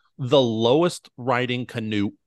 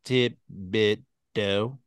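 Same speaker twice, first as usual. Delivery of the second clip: Thibodeau split into separate drawn-out beats; mock-slow, chopped-up Thibodeau; broken into syllables; 5 semitones lower, 4 dB quieter, much slower than usual. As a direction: say Thibodeau syllable by syllable, mimicking the character. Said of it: "break it out as Thibodeau".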